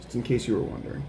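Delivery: ordinary delivery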